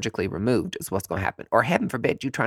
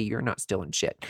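dismissive; 2 semitones lower, 9 dB quieter than usual. Retold or imitated. Retorting to rival injector.

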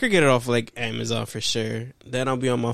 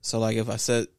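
ordinary talk, nothing marked

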